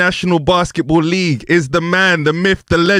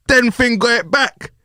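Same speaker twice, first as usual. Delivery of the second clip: ordinary delivery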